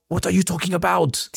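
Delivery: hammed-up, high French-accented comeback; bad French accent. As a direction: protest in a badly faked French accent. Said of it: "I can't do it. I'm gonna stop".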